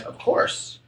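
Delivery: whispered, half asleep; drowsy whisper from a half-asleep dormouse; sleepy Dormouse. A narrator's reading. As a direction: breathe it out without waking. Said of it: "said without opening its eyes".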